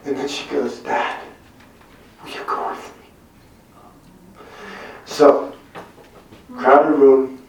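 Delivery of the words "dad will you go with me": put-on a soft, slow, near-whispered little girl's ask; small daughter asks her dad, slow and hushed; hushed child's plea. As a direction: ask Dad gently like a shy three-year-old. Daughter pleads, quoted.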